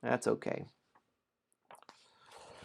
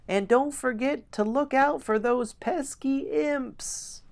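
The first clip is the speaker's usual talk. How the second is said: high, drawn-out gnome call; gnome yelling; 3 dB louder, slower than usual. A narrator's reading. Gnome calling a last warning down the hallway, high-pitched yell. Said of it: "the last thing you hear is a gnome yelling".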